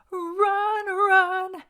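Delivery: high, loud mock belt of run. Reviewer imitating the singer's climax with a high loud wail. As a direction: wail it out like the singer at full power. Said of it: "all I hear is [name] going".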